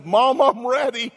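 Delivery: put-on proud little boy. Naked boy greets his mom.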